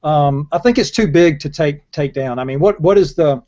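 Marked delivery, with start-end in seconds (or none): none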